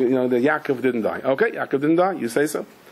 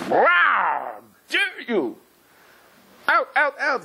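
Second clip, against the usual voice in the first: higher, imitating an indignant elder; mock outrage; 11 semitones higher, much slower than usual. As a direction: throw him out, hammed-up fury. Angry Rosh Hakol confronts guest.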